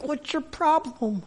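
high, slow, hushed question; imitated hushed wife. Wife to nervous husband in a packed elevator, hushed. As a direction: imitate a wife's pointed hushed aside. Wife's aside in a crowded elevator.

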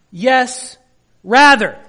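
"rather" hit hard and loud; preacher slamming down "rather"; stress on rather. Shown as a demonstration. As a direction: punch the word "rather" loud and falling. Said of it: "where does the rather then place the emphasis".

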